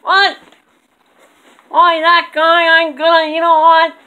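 high, loud outraged puppet voice; angry squeaky dummy. Acted.

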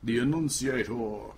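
The enunciator said slowly, every syllable; slow grand announcer enunciator; a hint of a pompous announcer; mock enunciator voice. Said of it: "this guy's gonna enunciate".

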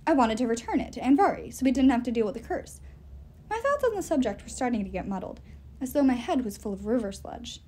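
ordinary delivery

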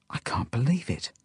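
hushed disbelief; stunned whisper. A narrator's reading. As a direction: whisper it to yourself in shock. Narrator stunned by friend's claim.

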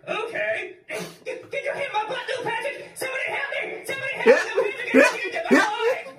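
high squeaky panicked puppet yelling for help; squeaky ventriloquist dummy. Acted. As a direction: shriek like a scared little dummy. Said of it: "ventriloquist dummy".

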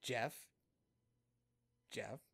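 name said calmly, long pause between; calm, dramatic pauses. Retold or imitated. Bandmate staring at Jeff before the punchline, calm and deliberate. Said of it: "you're very calm when you deliver that".

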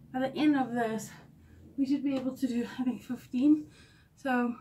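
ordinary talk

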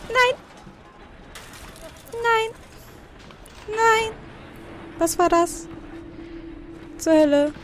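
high, frightened yelps; real alarm. Real, not acted. Player startled by something in the game, alarmed. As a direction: recoil in fright, voice jumping way up.

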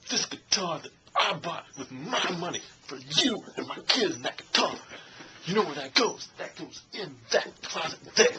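pointing, preachy Denzel rant, lightly voiced; Denzel Washington impression. Mocking Denzel in John Q scolding about a guitar, lightly voiced. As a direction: jab each word like pointing at things. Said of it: "That's basically Denzel Washington".

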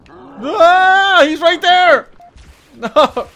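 loud, high cries of alarm; panicked screaming. Real, not acted. Gamer finds the hunter right there, screaming in panic. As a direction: yell out as it closes in.

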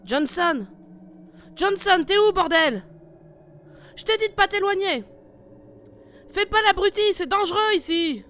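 loud, high yelling into the fog; angry shout. Acted. Leader shouting for lost partner in the fog, angry yell. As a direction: bellow for him, furious and worried.

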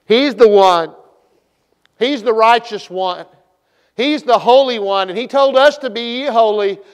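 loud, fervent preaching; full preaching. Acted. Preacher exhorting congregation, loud fervent preaching. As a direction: drive it home to the church.

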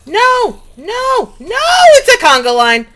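high loud no no no; panicked shouting. Real, not acted. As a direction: scream in panic. Panicking trapped in a mob line.

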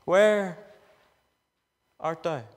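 low call fading to almost nothing. Acted. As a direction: let the question die away.

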